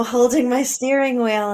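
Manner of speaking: ordinary talk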